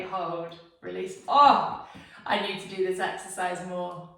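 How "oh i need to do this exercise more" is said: sudden pained cry from a leg cramp. Real, not acted. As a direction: blurt it out as the cramp hits. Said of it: "Oh, I'm cramping already".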